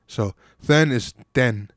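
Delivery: "then" with th, then "den" with a plain d, slowly; slow pronunciation demo. Shown as a demonstration. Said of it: "T H is pronounced th".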